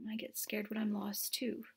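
soft confiding whisper. Real, not acted. Aside to child, whispered.

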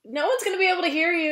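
high, quick call across to a roommate; real aside called out to another room; calling to someone. Real, not acted. Calls to roommate off mic.

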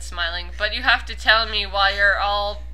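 normal talking voice

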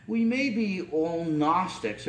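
ordinary talk, nothing marked

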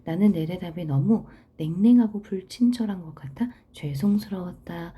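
ordinary delivery

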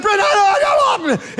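very high, garbled yelp of protest; grumbling, stumbling protest. Acted. Husband cutting off his wife's Bible talk, grumbling protest. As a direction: stumble over the words, whining high. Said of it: "I mumbled and grumbled and stumbled".

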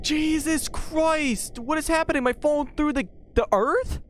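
high drawn-out startled cry; shocked exclaiming. Real, not acted. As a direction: react like something just jumped out. Shocked by game event.

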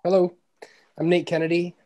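ordinary delivery

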